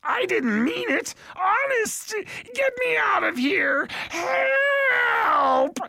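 very high squealing cries, building to a loud wail; desperate hobgoblin pleading. A narrator's reading. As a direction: shriek for rescue as a trapped creature. Hobgoblin pleads from griffin's beak.

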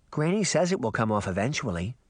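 plain reading voice

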